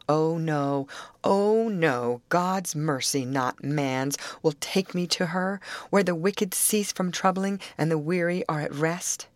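ordinary delivery, nothing marked

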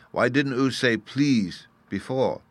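put-on a small child correcting manners; childish scold. A narrator's reading. Fairy boy scolding the narrator for forgetting please. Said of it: "the little fellow answered".

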